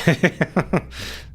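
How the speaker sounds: high airy burst of laughter; breathy laugh. Real, not acted. Laughing at own joke.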